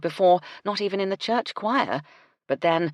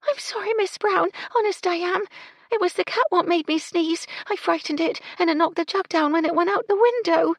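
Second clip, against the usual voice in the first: put-on high, girlish voice, apologetic; timid young maid; 14 semitones higher, 5 dB louder than usual. Young housemaid begs Miss Brown's pardon, high and tearful. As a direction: plead sorry like a frightened young maid.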